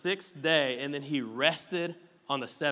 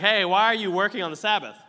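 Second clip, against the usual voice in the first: mock Pharisee challenge; 5 semitones higher, 7 dB louder than usual. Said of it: "they're like".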